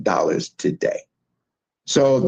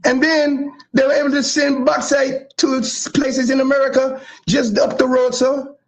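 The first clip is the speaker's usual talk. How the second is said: put-on Jamaican accent, pitched much higher; hammed-up Jamaican lilt, voice raised high throughout; false Jamaican accent; 12 semitones higher than usual. Honoring his co-host's Jamaican heritage, in a put-on Jamaican accent. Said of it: "I'm using my false Jamaican accent".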